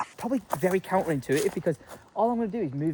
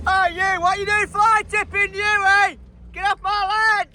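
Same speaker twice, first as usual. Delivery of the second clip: high, loud mock telling-off; mock angry landowner; 13 semitones higher, 3 dB louder than usual. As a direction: play the outraged farmer. Mock shouting at friend's van.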